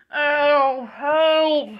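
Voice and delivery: loud, high holler; cry for help. Acted. Derailed Oliver crying for help, hollering. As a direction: call out for rescue, loud and high. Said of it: "Oliver screaming for help".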